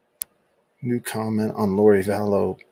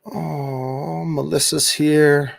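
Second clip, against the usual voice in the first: normal talking voice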